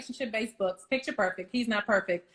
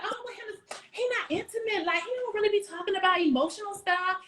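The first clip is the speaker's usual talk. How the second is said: mock whiny wife; 7 semitones higher than usual. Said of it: "then you're like".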